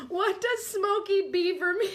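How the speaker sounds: high, strained question through giggles; crying with laughter. Real, not acted. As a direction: try to ask it while cracking up.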